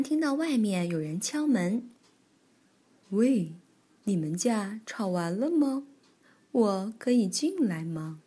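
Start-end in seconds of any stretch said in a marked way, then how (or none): 3.1-8.2 lightly voiced, unhurried granny; a hint of a granny at the door; old granny bear. Granny Bear asks to come in, lightly voiced old granny.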